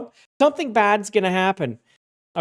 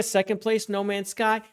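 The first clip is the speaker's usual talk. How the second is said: ordinary delivery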